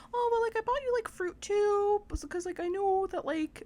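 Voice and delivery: high-pitched, sing-out imitation of a mom; exaggerated high mom voice; put-on mom voice. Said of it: "I don't know why I'm talking like that as my mom".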